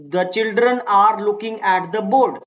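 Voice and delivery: slow model sentence. Shown as a demonstration. Teacher reads model sentence.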